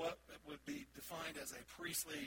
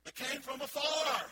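slow, loud, higher, falling "afar"; loud punchline; 7 semitones higher, 10 dB louder, slower than usual. Real, not acted. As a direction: land the joke once more, loud and drawn out. Repeats punchline again.

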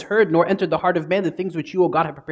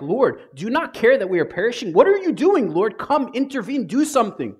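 panicked cry; 9 semitones higher than usual. Acted. Disciples in the storm begging Jesus, panicked.

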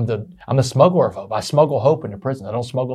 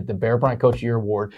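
normal talking voice

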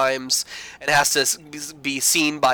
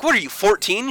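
higher, mocking put-down; condescending jab; 7 semitones higher than usual. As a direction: sneer it up high. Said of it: "he's like".

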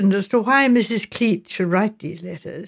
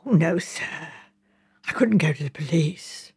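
hushed, softer, aghast whisper; shocked whisper; 4 semitones lower, 6 dB quieter than usual. A narrator's reading. Shocked charwoman refuses to go to police, whispering. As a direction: breathe it as if the idea is scandalous. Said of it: "looked deeply shocked".

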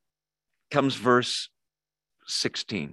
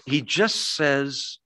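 usual speaking voice

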